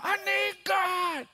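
drawn-out, very high mimicked wail; imitated desperate cry. Man hitting the steering wheel, imitated desperate cry. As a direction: cry out for God, slow and high.

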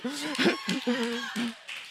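real high-pitched chuckle; laughing. Real, not acted. Preacher amused by his dating remark, laughing. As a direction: break into a soft, helpless laugh.

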